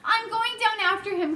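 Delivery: urgent declaration; cried. Acted. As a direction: brave boy rushing to help. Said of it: "cried James".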